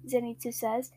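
usual speaking voice

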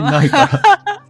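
laughing echo, slow and loud. Real, not acted. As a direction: repeat it while cracking up. 相手の言葉を笑って繰り返す. (笑いながら繰り返す)

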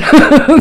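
high, loud burst of laughter. Real, not acted. Joking that manic people won't calm down, bursting laugh. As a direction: break into a quick amused cackle.

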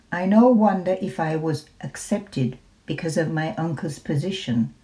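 ordinary delivery, nothing marked

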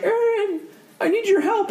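very high-pitched imitation of an excitable kid; sixth-grade boy. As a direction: squeak it like a needy little boy. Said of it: "this little sixth grade guy".

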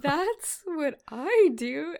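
baffled quote. Retold or imitated. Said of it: "I was like".

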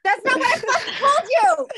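high, loud indignant outburst; loud protest. Real, not acted. Streamer protesting a wrong answer in Spanish banter, loud protest. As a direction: pitch it way up and yell the objection. Objecting to being misquoted in banter.

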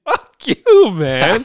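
loud, high burst of exasperation; high-pitched comic shout; exasperated outburst. Real, not acted. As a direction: throw it out loudly at the film. Exasperated end of film rant.

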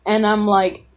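ordinary delivery

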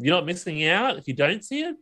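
ordinary delivery

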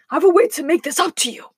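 fast, high, pleading in panic; panicked. A narrator's reading. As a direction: bargain hurriedly as a panicked wolf. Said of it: "cried the wolf in panic".

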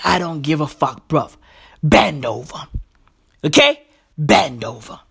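low threat, no mercy; menacing Russian players. Retold or imitated. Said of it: "They're like".

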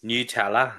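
Nutella said as "Nyoo-tella"; imitated new pronunciation. Shown as a demonstration. Co-host tries out Nutella as "Nyoo-tella". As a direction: start Nutella like "New" in New Delhi, rising. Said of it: "Like New Delhi".

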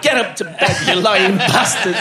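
mock yell, laughing; wife yelling from upstairs, laughing; Dove's yell. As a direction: shout it up the stairs, half laughing. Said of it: "Dove went".

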